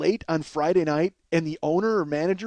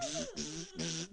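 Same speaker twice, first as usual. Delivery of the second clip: soft sputtering zaps; short hissed spark noises; imitated electric buzz; 10 semitones higher, 19 dB quieter, slower than usual. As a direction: buzz like a broken neon sign. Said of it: "The sign is like".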